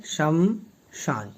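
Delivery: शमशान said very slowly, a half श; pron. Shown as a demonstration. Teacher sounds out शमशान for students, very slowly. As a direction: draw शमशान out slowly, clipping the first श. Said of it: "ये आधा श आता है".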